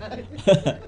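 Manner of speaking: short amused chuckle; breathy little laugh. Real, not acted. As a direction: chuckle at your own joke. Laughing at own mock pronunciation.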